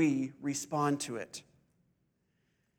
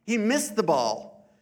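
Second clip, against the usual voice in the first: ordinary delivery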